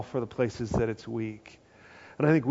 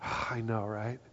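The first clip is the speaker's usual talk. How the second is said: resigned sigh; 3 semitones lower, 11 dB quieter than usual. Retold or imitated. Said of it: "And I go".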